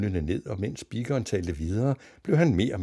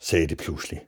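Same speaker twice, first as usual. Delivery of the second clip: ordinary delivery, nothing marked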